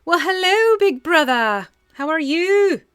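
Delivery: put-on loud, high French-accented greeting; Tylea's French accent. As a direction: call out a big hello in a French accent. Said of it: "bring that accent to Tylea".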